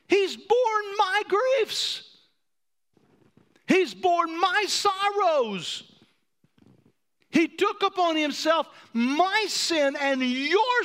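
very high, loud, slow cry; preacher's climactic wail; impassioned preaching. Acted. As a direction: stretch each word out, pleading.

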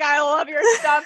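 high, squeaky words through laughter; laughing quote. Real, not acted. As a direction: crack up while quoting a fan. Cracks up quoting boss's praise.

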